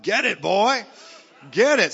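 loud, high cheer from the heavenly stands; cheering witnesses. Acted. Great cloud of witnesses egging on today's believers, loud cheering. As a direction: urge him on like a rowdy supporter. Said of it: "great cloud of witnesses would be up there today saying".

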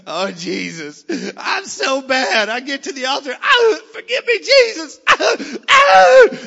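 mock sobbing plea, climbing to high shrieks; hammed-up penitent wailing. Guilt-ridden churchgoer begging at the altar, hammed-up wailing. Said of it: "You're like".

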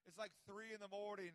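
ordinary delivery

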